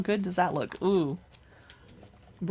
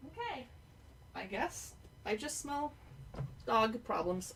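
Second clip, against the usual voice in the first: hushed, higher, gentle voice for the dog; soft pet talk; 4 semitones higher, 13 dB quieter than usual. Real, not acted. Checking on her dog, soft cooing pet talk. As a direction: coo softly at a little dog.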